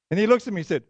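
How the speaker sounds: ordinary delivery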